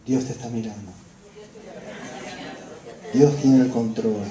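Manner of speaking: comforting words, low and soft; reassuring whisper-soft. Shown as a demonstration. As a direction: soothe a grieving friend, low and gentle.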